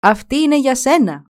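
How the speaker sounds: storybook shout, moderately lifted; calling out. A narrator's reading. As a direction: call it up to a friend. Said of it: "φώναξε στον λαγοπιάστη".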